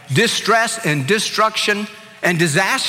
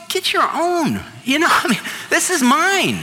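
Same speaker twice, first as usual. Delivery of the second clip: indignant retort; 8 semitones higher than usual. Retold or imitated. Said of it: "I didn't say that".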